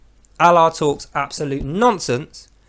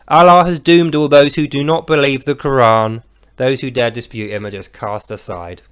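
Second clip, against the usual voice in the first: drained of energy; 4 semitones lower than usual. Acted. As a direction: run out of steam by the last words. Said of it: "the no energy, sloth-like, totally drained".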